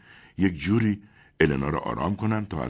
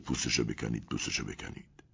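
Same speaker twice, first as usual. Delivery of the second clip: soft, low, fading to a whisper; muttered repetition; 6 semitones lower, 9 dB quieter than usual. A narrator's reading. Elena repeating the phrase compulsively, softly whispered. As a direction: repeat it under your breath.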